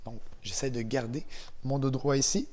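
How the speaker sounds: ordinary talk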